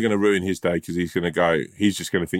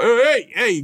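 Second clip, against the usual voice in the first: much higher, louder calling hey hey; mock hailing; 4 dB louder, slower than usual. Stranger in a busy cafe hollering at him, mock call. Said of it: "he was like".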